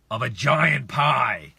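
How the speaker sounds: slow, loud, drawn-out GIANT PIE; playfully oversized delivery; big emphatic boom. Real, not acted. Playing up size of the pie.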